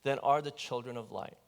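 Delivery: low, soft, measured Bible reading; scripture reading. Acted. Preacher reading the Gospel to the congregation, solemn and measured. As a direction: read the passage like a lector. Reads the scripture passage aloud.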